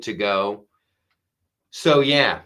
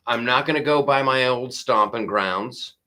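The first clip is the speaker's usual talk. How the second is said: normal talking voice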